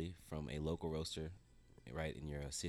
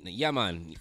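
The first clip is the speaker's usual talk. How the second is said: loud imitation of a Jamaican uncle; Jamaican accent; 9 dB louder than usual. Nephew quoting his uncle back home, Jamaican accent. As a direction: put on a big Jamaican accent. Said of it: "with a Jamaican accent".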